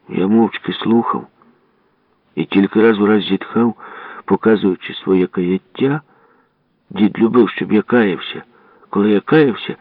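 plain reading voice

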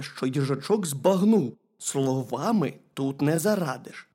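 plain reading voice

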